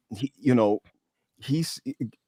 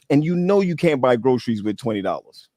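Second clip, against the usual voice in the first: ordinary talk, nothing marked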